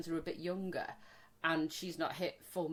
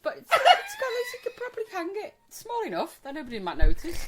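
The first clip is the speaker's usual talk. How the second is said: high laughing; laughing as she explains; high, loud laughing; 11 semitones higher, 9 dB louder than usual. Real, not acted. Laughing about the gift.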